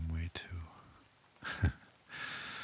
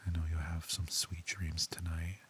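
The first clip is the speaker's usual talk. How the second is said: whisper in left ear. Acted. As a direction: close whisper into one ear. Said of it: "With me whispering into your ear".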